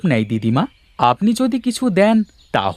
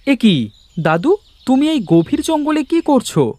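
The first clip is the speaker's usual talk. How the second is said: surprised; 11 semitones higher than usual. Acted. Boy finds old man crying.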